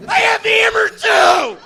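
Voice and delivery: high yelled cartoon voice; squeaky character shout. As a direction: shriek it like a cartoon. Absurd impression bit.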